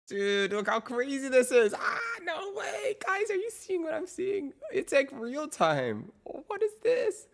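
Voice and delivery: amazed shouting. Real, not acted. Amazed at new AI model.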